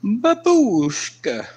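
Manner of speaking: high, slow, drawn-out silly voice; high, slow playful voicing; put-on funny voice. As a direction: stretch the word out like a cartoon grandma.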